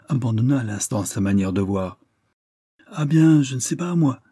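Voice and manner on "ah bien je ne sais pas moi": high-pitched, artless reply; naïve candour. A narrator's reading. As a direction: start high and loud with innocent candour. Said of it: "dit-il avec une admirable naïveté". (avec une naïveté candide)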